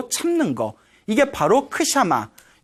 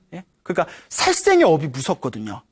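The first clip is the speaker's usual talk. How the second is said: usual speaking voice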